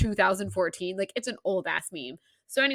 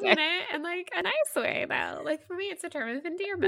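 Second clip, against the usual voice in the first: much higher, light protesting voice; mock defensive; 12 semitones higher than usual. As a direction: friend explaining the word to her sister, mock earnest. Voicing friend defending word.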